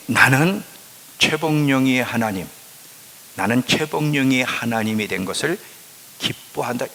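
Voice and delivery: ordinary talk, nothing marked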